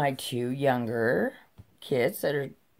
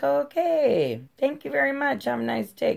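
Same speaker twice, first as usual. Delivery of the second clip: high, singsong fake politeness; see them off in an exaggerated high polite voice; mock-sweet brush-off; 12 semitones higher than usual. Mom dismissing health inspectors after showing garage, mock-sweet.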